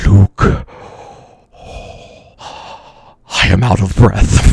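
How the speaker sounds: mock winded villain, low and breath-heavy; Darth Vader. Streamer trying another villain line for laughs, low breathy impression. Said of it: "how about this?".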